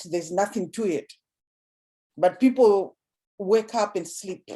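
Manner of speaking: usual speaking voice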